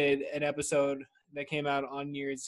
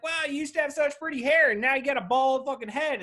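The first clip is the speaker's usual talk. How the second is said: mock gushing; 10 semitones higher, 6 dB louder than usual. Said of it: "And be like".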